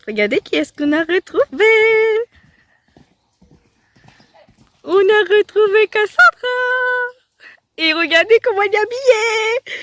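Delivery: high, loud, gushing delight; excited squealing reveal. Real, not acted. Vlogger revealing Cassandra and her outfit to viewers, loudly excited. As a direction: show it off with loud, thrilled excitement. Showing off friend and new outfit.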